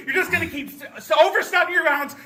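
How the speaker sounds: normal talking voice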